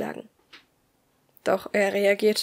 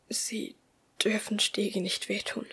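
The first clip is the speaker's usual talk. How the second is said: soft, fading out before fainting; fading last thought; 8 dB quieter than usual. A narrator's reading. Narrator's last thought before blacking out, soft and fading. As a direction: think it faintly while blacking out. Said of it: "war mein letzter Gedanke vor der Ohnmacht".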